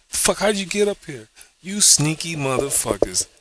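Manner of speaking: high mock-scolding God voice; hammed-up God chewing them out; annoyed God. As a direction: scold the trespassers like an irate God. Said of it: "God was like".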